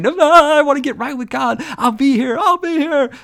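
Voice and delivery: high, loud eager promise; eager teen. Retold or imitated. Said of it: "one teacher would say".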